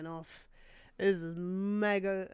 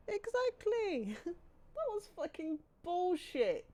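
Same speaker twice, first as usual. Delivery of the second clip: emphatic; 10 semitones higher, slower than usual. Real, not acted. Streamer agreeing with chat.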